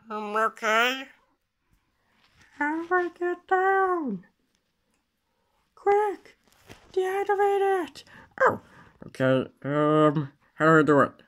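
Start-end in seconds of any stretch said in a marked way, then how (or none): 2.6-8.6 high-pitched panicked questions; panic, voice jumping up high. Real, not acted. Panic over gravity in game.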